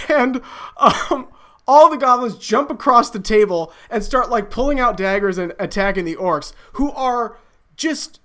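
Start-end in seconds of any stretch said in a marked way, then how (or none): none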